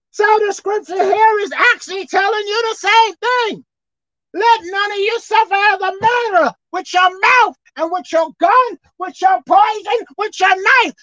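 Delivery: preaching. Acted. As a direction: preach it to the pews, building to a shout. Preacher exhorts the congregation.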